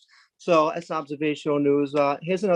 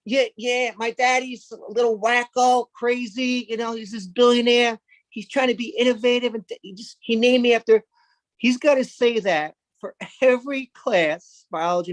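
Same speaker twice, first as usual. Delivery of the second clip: put-on higher, sheepish child voice; a boy explaining his dad; embarrassed kid; 6 semitones higher than usual. Musk's son answers teacher.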